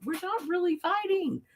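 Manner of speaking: high-pitched placating plea; mock innocent protest. Retold reassurance to bouncers.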